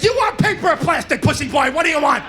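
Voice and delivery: a hint of a cranky old war veteran; grumpy old bagger. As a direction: needle the customer like a crusty veteran. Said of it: "He's like".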